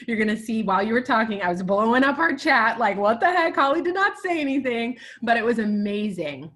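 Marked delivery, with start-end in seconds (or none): 3.0-5.0 loud, high-pitched mock outrage; re-enacted excited disbelief; retold astonishment. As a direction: exclaim it as if stunned by a friend's secret. Retells her astonished chat messages.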